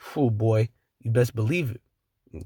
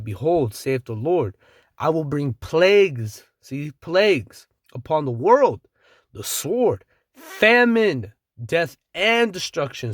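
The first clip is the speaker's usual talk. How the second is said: rising, louder preaching with slow stressed words; scripture reading; 3 semitones higher, 4 dB louder, slower than usual. Acted. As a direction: build the plague list to a loud peak on famine. Preacher reading plague verse.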